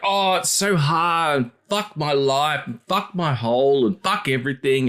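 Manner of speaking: high, slow whiny moaning; hammed-up whining complaint; mock self-pity. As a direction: whine it like a martyr fishing for sympathy. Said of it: "they're like".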